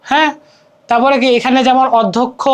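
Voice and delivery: ordinary talk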